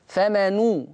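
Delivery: فمن said as "famanu", ending on "u"; damma on nun. Shown as a demonstration. Teacher pointing out the damma, فمن ending in "nu". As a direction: end فمن on a clear "nu". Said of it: "لاحظوا الضم".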